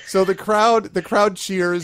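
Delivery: high, loud announcer call of the cheer; booming cheer. Acted. GM announces the crowd cheering, big and loud. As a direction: boom it out like the crowd roars.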